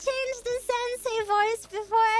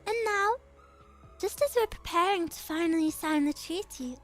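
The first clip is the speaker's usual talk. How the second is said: lower, measured and calm; composed noble girl; 6 semitones lower, 3 dB quieter than usual. Acted. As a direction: speak with quiet authority. Same character voice continues.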